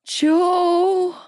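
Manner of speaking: a pained groan pitched high. Acted. She groans to Joe mid-labour, in pain. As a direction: groan his name through the pain. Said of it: "I groaned".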